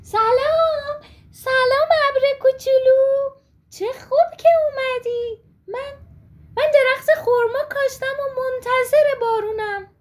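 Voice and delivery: high, loud call up to the sky; a little girl calling out with joy; shouting up to a little cloud; excited shout. Acted. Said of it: "فریاد کشید".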